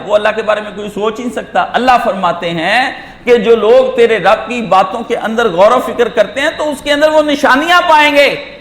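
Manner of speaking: ordinary delivery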